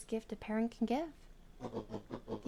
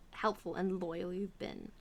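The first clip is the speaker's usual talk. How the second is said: normal talking voice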